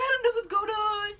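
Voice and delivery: loud, high mock wail; hammed-up tearful confusion; imitated bawling. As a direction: bawl it helplessly. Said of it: "I'm just crying".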